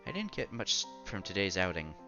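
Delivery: normal talking voice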